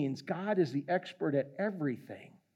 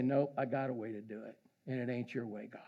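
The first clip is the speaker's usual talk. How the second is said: quiet, dismissive mock defiance; defiant sinner; 7 semitones lower, 10 dB quieter, faster than usual. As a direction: brush God off under your breath. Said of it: "The people who say".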